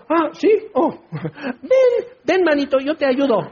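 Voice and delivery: high, gushing mock eagerness; fake helpfulness. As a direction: imitate a two-faced crowd suddenly eager to help. Hypocrite crowd suddenly turns helpful.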